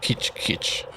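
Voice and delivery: soft unvoiced clicking "Kitch, kitch"; radio creature clicking; creature clicks. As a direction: hiss the clicks. Imitating a creature noise.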